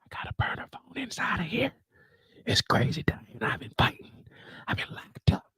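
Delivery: hushed unvoiced whisper. Drew on a burner phone in jail, whispering. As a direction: whisper so the guards can't hear. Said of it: "why are you whispering".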